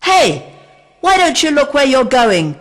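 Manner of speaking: loud high shout from above; grumpy bird shouting down. A narrator's reading. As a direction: yell down crossly from a tree. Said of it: "shouted a voice from a tree".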